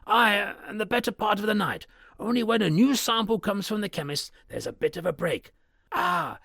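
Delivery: the servant urging his master to listen; uneasy old butler. Acted. Butler Poole voiced, uneasy.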